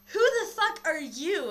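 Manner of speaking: loud, high, drawn-out retold demand; indignant challenge. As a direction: act out confronting an intruder. Acts confronting intruder in story.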